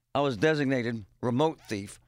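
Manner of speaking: ordinary talk, nothing marked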